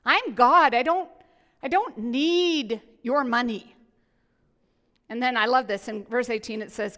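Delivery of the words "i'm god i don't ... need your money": booming, emphatic voice of God, louder; God declaring loudly. Retold or imitated. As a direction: proclaim it as God, insisting he needs nothing. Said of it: "turns the tables on us and says".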